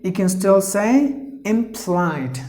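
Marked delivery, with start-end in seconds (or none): 1.4-2.4 "impolite" said as im-plite, two syllables; dropped O. Shown as a demonstration. Teacher showing you can still say it, im-plite. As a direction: skip the O in "impolite". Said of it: "Without the O".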